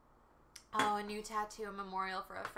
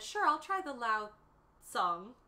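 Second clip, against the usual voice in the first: put-on gentle older lady; 3 semitones higher, 8 dB louder, slower than usual. Retelling the kind Chinese customer's gentle correction. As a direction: gently correct the tea name. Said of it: "She was so gentle about it".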